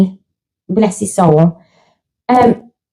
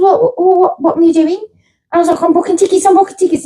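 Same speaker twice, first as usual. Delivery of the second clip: much higher, hurried; frantic excitement; 6 semitones higher, faster than usual. Retold or imitated. Host retells answering her partner mid-booking, frantic. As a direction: gabble it out in a frenzy.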